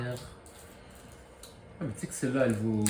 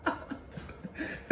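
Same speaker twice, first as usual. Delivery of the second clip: laughing excitedly; 12 semitones higher, 9 dB louder than usual. Real, not acted. Excited about expensive card.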